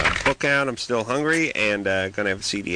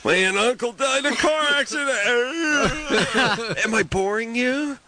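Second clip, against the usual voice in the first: high, yawn-stretched voice; mock yawning; 12 semitones higher than usual. Shown as a demonstration. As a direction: act out yawning through bad news. Acts yawning at bad news.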